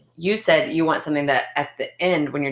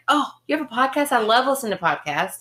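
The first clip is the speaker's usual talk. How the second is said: high-pitched, keenly interested dentist voice; imitated dentist; 7 semitones higher than usual. Dentist hearing about the podcast, high imitated interest. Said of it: "and the dentist was like".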